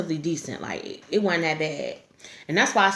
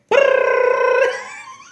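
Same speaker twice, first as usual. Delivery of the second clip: shrieking laughter, much higher and louder; high cry-laugh; 7 dB louder than usual. Real, not acted. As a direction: burst out wheezing with laughter. Laugh alone on line.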